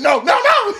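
high, loud string of cries; alarmed warning. Retold or imitated. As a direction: yell to stop a crash. Acting out panic before the crash.

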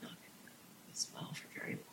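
barely audible whisper; tiny, very soft snail-voice whisper; little inner voice. Acted. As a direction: whisper it like a nagging doubt in the head. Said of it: "that little voice just says".

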